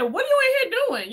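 high, fast mock accusation; an aunt questioning her nephew, high and fast; suspicious aunt. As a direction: pitch it up high, quick and prying. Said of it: "And I said".

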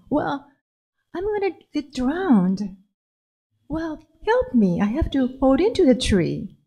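a touch of panic, calling for help; lightly voiced girl, mildly alarmed; mildly scared girl. Lily in the tear river.

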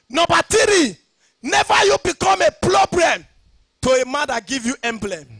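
loud, high, driving pulpit shout; fiery sermon cadence, ringing out; preaching at full force. Acted. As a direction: hammer each line home to the church. Preaching point three.

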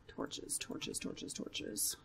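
near-whispered, anxious repetition; terrified hush. Real, not acted. Frightened player hunting for torches at nightfall, terrified hush. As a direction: mutter it urgently under your breath. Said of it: "really quiet because I'm terrified".

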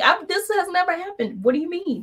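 shocked disbelief. Retold or imitated. To the tow company on the phone, high and baffled. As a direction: let the pitch jump up in disbelief. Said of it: "I was like".

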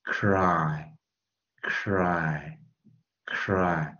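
"cry" with the vowel drawn out, three times; long-vowel demonstration. Shown as a demonstration. Teacher drilling the long vowel in cry, slow and stretched. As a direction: stretch cry slowly, pausing between repetitions. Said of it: "所以念长元".

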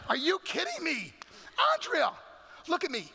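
high, loud exasperated outburst; acted furious big-brother telling-off; angry scolding. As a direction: tear into a little sister. Acted anger at little sister.

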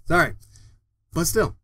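ordinary talk, nothing marked

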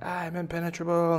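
high-pitched invincible boast; boastful baby bunny. Acted. Bunny defying the airplanes, high boast. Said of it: "And he's like".

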